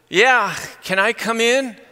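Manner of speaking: high, slow, stretched-out call. Retold or imitated. Pastor asking through the screen door to come in, calling out. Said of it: "and I yell out".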